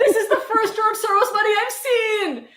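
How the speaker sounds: high-pitched, laughing through the words; laughing mock joy. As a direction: giggle as if overjoyed by the payout. Laughing over fake Soros money.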